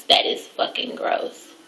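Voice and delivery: whispered, drawn-out disgust. Real, not acted. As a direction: whisper it in revulsion.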